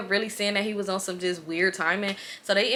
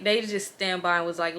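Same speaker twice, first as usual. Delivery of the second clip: normal talking voice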